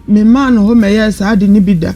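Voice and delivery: normal talking voice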